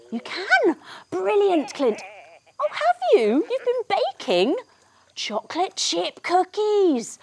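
high, gushing phone reaction; hammed-up excitement for children; delighted surprise. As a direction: react thrilled to a friend's news on a pretend call. Hears good news on pretend call.

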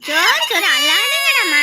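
loud pleading hush over crying; urgent, loud comforting; loud soothing. Acted. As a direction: raise your voice to calm a wailing baby.

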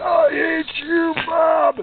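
high, loud, stretched-out scream at Bob; a hammed-up angry yell; mock scream. Shown as a demonstration. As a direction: scream it out like you're mad at someone. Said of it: "if you're mad at somebody, you can scream there".

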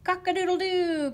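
high, loud, long crow; rooster crowing. A narrator's reading. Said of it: "crowed Rooster".

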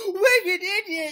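slow, drawn-out cry of a name; excited greeting. Acted. Host greets arriving guest with drawn-out joyful cry. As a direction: call out the guest's name with delight.

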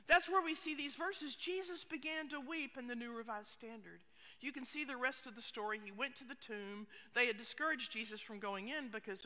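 ordinary talk, nothing marked

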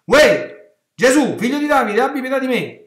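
sudden high, loud yell for mercy; shouting like a madman. Acted. Blind man yelling to Jesus as he passes, high desperate shout. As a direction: cry out wildly to be heard. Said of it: "comincia a strillare come un pazzo".

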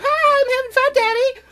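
squeaky, very high mock kid voice; playing child. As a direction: imitate an excited little kid at play.

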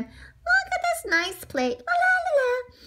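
high, happy sing-song; pleased admiring lilt. Acted. Arnie admires his handsome new plate, high happy lilt. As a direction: lilt along high and carefree.

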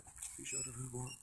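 soft, very high little voice; tiny high-pitched repeat; small-voiced echo. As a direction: echo it back high and quiet.

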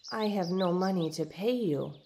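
ordinary delivery

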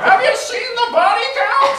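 high, loud, laughing Stallone voice; Stallone impression. Still doing Stallone for the crowd, high and loud. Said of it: "That's my Stallone".

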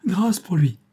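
high, loud desperate plea; pleading scream. A narrator's reading. Giulietta to her father with the gun, high loud plea. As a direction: beg for his life at full voice. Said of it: "s'écria Giulietta".

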